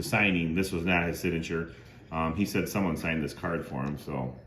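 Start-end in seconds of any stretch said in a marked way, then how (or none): none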